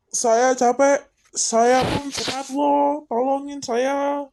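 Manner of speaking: high, drawn-out plaintive cry; mock pleading. Mafia boss being laughed at, high mock pleading. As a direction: draw out a high plea for help.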